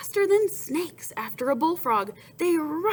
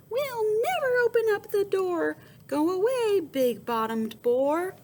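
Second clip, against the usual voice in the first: calling back; 11 semitones higher, 4 dB louder than usual. Acted. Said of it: "they called back".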